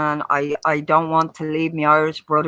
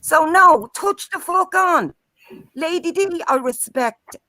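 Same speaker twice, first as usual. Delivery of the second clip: getting angry; 9 semitones higher, slower than usual. Real, not acted. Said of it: "I'm getting a little angry".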